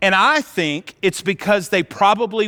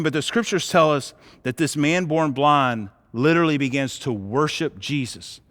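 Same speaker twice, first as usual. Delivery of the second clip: usual speaking voice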